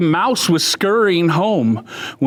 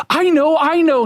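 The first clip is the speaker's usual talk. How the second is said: put-on eager child; 11 semitones higher than usual. Eager child answers teacher.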